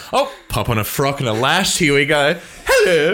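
hammed-up drag-act patter; man in drag. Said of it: "They're like".

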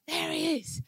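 hushed, imitated American bystander; generic American accent. American guy to the couple at the fence, soft imitated accent. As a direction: put on an American guy, softly pointing something out. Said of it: "guy with the generic American accent comes".

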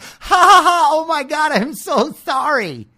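high, giddy, laughing stoned voice; mock a blissed-out festival kid giggling through an apology; giggly hippie laugh. Mocking a stoned hippie bumping into people, high and giggly. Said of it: "then laughing like".

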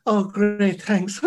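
high, loud mock gratitude; imitated relieved host. Guest mimicking the host charmed by a lady, high mock gratitude. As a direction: ham up the relief, pitched well up. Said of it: "And you go".

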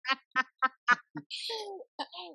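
laughing in disbelief at the story; a real burst of high giggling; high helpless laugh. Real, not acted. Host laughs at Owen's bathroom lockout: helpless high laugh. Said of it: "I don't know why I'm laughing".

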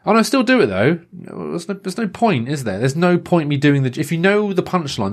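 high, sing-song fake enthusiasm; overly keen coworker; mock eager colleague.

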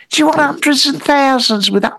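high-pitched serving-lady voice; monkey lady. Acted. Monkey lady serving ice cream, high put-on voice. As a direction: pitch it up like a lady taking an order. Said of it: "there was one monkey lady".

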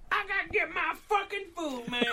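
high put-on old woman's voice; imitated ferry lady. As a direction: mimic a rowdy lady in a high-pitched voice.

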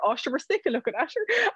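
high teasing quote; high mock reply. Daughter joking to her mum, high and teasing. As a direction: toss it off as a joke. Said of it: "I said to her".

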